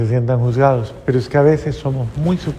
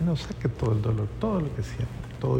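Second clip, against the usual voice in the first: gentle coaxing; 9 dB quieter, faster than usual. Retold or imitated. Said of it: "le dije".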